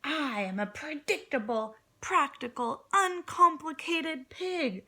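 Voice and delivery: building from level to loud, high yell. Acted. Angry pig yelling at Pickles, rising yell. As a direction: let the pig's anger swell into a yell. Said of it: "he yelled".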